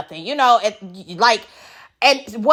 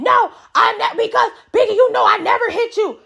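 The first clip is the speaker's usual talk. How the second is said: much higher, shouted protest; mock Kiva yelling; 10 semitones higher than usual. Kiva yelling at Biggie that she never hit her, mock shout. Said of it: "she's yelling about nothing".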